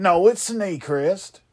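stubborn, slow "Snaycrest" said as "Snay-crest"; "Snaycrest" said slowly and firmly; insisting on Snaycrest. Shown as a demonstration. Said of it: "Snaycrest is how I'm gonna snay it".

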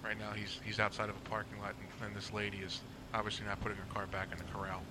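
low, soft commentary over a clip; quiet explanatory aside; hushed aside. Real, not acted. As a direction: drop well down in pitch and volume to explain.

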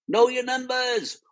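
high-pitched drawn-out mock scream. Viewer yelling advice at pitchers on TV, mock screaming. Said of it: "We're all either screaming at the T V".

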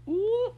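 very high, held "ooh"; stifled-laugh "ooh". Retold or imitated. Anne watches the row, trying not to laugh. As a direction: imitate someone holding back laughter. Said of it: "trying not to laugh".

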